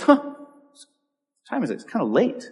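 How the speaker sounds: slow, soft, casual murmur; offhand shrug. Retold or imitated. Jesus at the temple, unbothered and softly casual. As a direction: shrug it off as if nothing matters. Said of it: "he goes".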